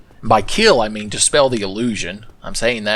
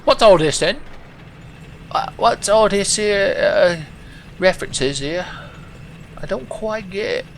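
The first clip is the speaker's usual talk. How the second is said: high-pitched, hammed-up British accent; fake British accent; 10 semitones higher than usual. Baffled viewer of own old videos, fake British accent. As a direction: put on a fake, bewildered British voice. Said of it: "British accent. A fake British accent".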